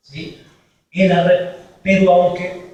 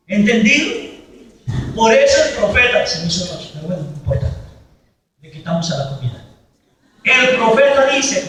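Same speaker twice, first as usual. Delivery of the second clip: ordinary talk, nothing marked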